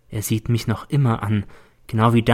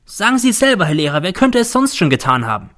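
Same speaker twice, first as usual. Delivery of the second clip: higher, louder angry plea; heated appeal; 7 semitones higher, 4 dB louder than usual. A narrator's reading. Z appealing to the teacher, heated. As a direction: demand the teacher agree.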